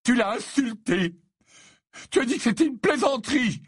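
high, loud lovesick fury; outraged exclamation. A narrator's reading. Ron defends his beloved to Harry, shrieking indignantly. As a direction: accuse him at a high-pitched yell. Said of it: "s'exclama Ron".

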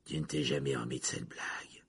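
low, muted grumble; growl. A narrator's reading. Prisoner taunts his old enemy, growling.

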